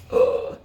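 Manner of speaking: loud exasperated ugh; frustrated groan. Acted. Reader groans loud at the puppy's oink. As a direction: groan like a fed-up mom.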